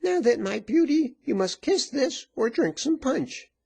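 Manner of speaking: high, loud taunting woman; high-pitched bullying ultimatum; menacing virago. A narrator's reading.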